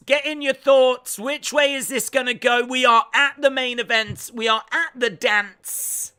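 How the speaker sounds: high, loud, projected hype call; main-event hype announcer. Acted. Streamer urging chat before main event, hype announcer. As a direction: whip up the crowd like a ringside announcer.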